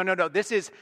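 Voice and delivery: ordinary delivery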